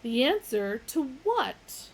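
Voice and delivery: puzzled computer voice; slow machine query. Acted. Deep Thought questions.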